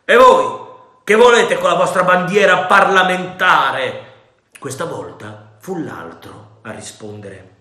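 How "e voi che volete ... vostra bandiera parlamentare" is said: loud, high stern question; demanding. A narrator's reading. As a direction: call out to the mutineers. Captain addresses the rebels.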